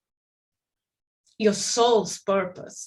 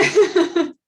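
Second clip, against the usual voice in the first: real laugh, higher and louder; amused laugh; 8 semitones higher, 8 dB louder than usual. Real, not acted. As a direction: burst briefly into amused laughter. Laughs at starting with a bomb.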